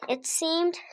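ordinary delivery, nothing marked

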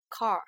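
Car with a curled final R; American R. Shown as a demonstration. Teacher showing the American difference, R-coloured Car. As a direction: finish Car on a clear American R. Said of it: "美式发音跟英式发音这里是一个区别".

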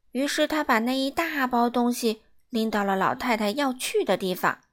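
plain reading voice

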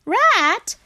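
high, loud frog calling out; high-pitched hailing cry; eager frog voice. A narrator's reading. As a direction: call a friend's name in a high little voice. Frog calls out to Rat.

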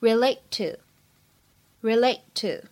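"relate to" with only one released t; slow "relate to", first t swallowed; lost plosion. Shown as a demonstration. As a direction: hold the t of "relate" silently into "to". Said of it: "可以有失去爆破的现象".

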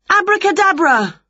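very high magical incantation; spell casting. A narrator's reading. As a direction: cry out the magic word.

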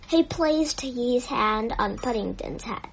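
ordinary delivery, nothing marked

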